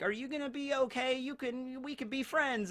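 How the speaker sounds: put-on little kid voice. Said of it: "as a little kid".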